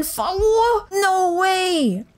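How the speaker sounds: high, crying out in disbelief; stunned no way. Real, not acted. Gamer crying out as the jail door shuts. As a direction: yelp it in disbelief.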